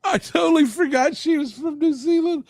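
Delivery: blurting out the realization; surprised shout. Real, not acted. Realizing his mistake about Zoe Bell, surprised shout.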